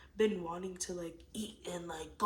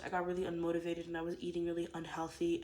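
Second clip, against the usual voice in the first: ordinary talk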